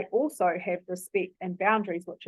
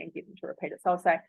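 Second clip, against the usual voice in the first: usual speaking voice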